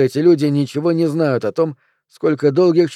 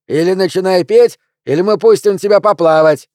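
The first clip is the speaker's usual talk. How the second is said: high, loud menace; threatening yell; 6 semitones higher, 6 dB louder than usual. Acted. Heckler threatening Pelvis from the crowd, loud and high. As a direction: threaten him over the noise.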